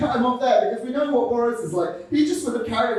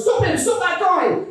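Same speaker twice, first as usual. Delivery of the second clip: high, urgent mock yell; calling out; 8 semitones higher than usual. Man chasing another over a bridge, high urgent call. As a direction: imitate a pursuer calling for help. Chaser calls out after running man.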